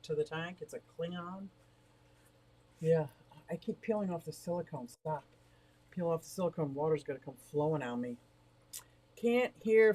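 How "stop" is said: ordinary delivery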